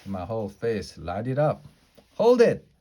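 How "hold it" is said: loud, high "hold it"; sudden excited shout. Acted. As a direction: burst out with a new idea. Sudden bright idea stops everything.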